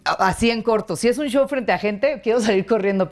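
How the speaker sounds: ordinary talk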